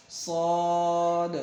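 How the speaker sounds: صاد held long with rounded vowel; stretched صاد. Shown as a demonstration. Teacher models correct reading, صاد long and full. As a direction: chant صاد slowly, bouncing the final D. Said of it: "کلکلا کرنا ہے یہاں پر".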